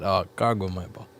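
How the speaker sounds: ordinary talk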